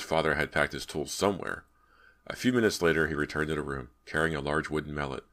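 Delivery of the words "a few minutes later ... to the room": usual speaking voice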